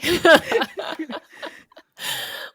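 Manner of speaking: bursting into a loud laugh; loud delighted laugh. Real, not acted. Laughing about Bruce Lee call.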